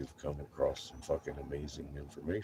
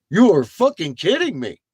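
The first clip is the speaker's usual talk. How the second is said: much higher, louder disbelieving shout; incredulous yell; 11 semitones higher, 13 dB louder than usual. Retold or imitated. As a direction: yell it like you can't believe your eyes. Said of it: "I'm like".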